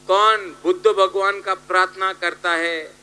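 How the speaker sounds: ordinary talk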